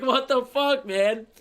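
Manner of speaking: mock exclamation from his old video; imitating old self. Streamer mimicking himself in an old video, lightly exaggerated impression. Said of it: "I was doing this in the fucking video".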